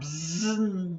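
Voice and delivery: buzzing like a bee; quick bee buzzing noise. Shown as a demonstration. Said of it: "I'll do a bee sound".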